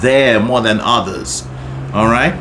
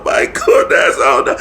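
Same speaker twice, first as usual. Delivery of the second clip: laughing. Real, not acted. Laughing at herself.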